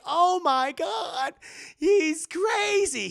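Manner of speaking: high, slow, drawn-out panic; hammed-up frightened Willie; panicked gasp. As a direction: squeal in terror.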